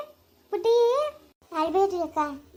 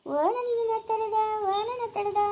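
put-on baby talk; 5 semitones higher than usual. Cooing at the newborn baby.

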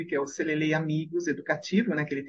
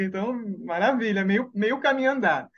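ordinary delivery

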